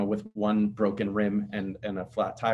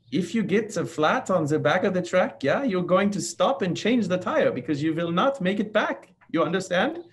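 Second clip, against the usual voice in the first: mock German accent, much higher, th turned to z; hammed-up German instructor accent, pitched up; bad German accent; 8 semitones higher, 3 dB louder than usual. German crew chief warning the drivers about flats, in a mock German accent. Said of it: "I'll spare you my bad German accent".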